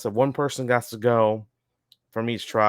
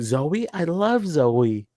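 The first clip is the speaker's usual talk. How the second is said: higher-pitched, drawn-out imitated disbelief; mock surprise; 6 semitones higher, much slower than usual. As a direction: imitate someone taken aback, slow and high. Retold surprised reaction to a name.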